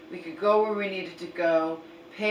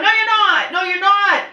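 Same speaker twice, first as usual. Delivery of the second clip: loud, high, insistent no; raised voice insisting her umbrella is up; high exasperated scolding; 9 semitones higher, 10 dB louder than usual. Retold or imitated. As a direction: scold mom, repeating no sharply. Arguing with mom.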